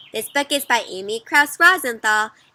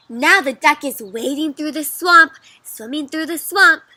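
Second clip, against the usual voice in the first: animated claim; 4 semitones higher, 8 dB louder than usual. Acted. Duck believer tells its version.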